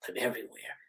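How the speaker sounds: menacing whisper; Ghostface. Acted. Killer answers Gail's "where are you", whispering. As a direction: whisper it like a phone stalker. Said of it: "getting a call from Ghostface".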